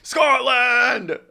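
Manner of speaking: loud, high Scottish shout of Scotland; a hammed-up Scottish accent roaring Scotland. Shown as a demonstration. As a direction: cheer Scotland like a Scottish fan. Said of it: "don't say it without the accent".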